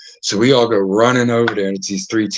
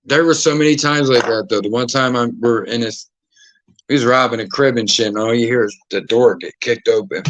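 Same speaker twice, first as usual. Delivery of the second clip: normal talking voice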